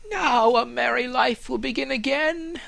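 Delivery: high, loud, joyful tree voice; joyful fir tree. Acted. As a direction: brim with hope.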